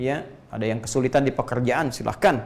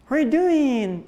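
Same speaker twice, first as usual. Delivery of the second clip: high, put-on English accent in English; 11 semitones higher, slower than usual. Preacher showing the congregation how Indonesians sound English, in a put-on English accent. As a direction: play an Indonesian mimicking a native English speaker. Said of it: "kayak orang Inggris".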